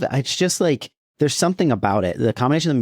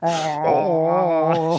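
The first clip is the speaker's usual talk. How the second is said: moaning secretary; 7 semitones higher than usual. Retold or imitated. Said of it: "she's just going".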